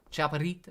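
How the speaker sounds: "Chaparrita" opening with "ch", as a question; CH pronunciation. Shown as a demonstration. Streamer asking if the Spanish is right, CH pronunciation. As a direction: try "Chaparrita" with a clear "ch", tentatively. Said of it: "C H is like a ch, ch sound?".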